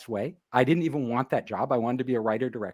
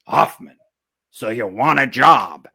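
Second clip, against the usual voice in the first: lower, louder and slow, a boss sizing him up; imitating the producer asking about a job; gruff producer; 3 semitones lower, 8 dB louder, slower than usual. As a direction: play the big-shot producer, slow and heavy. Voicing the producer's gruff question.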